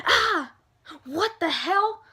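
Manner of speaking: yelp, then indignant disbelief; pained shock. Retold or imitated. Morphine wears off, sudden pain.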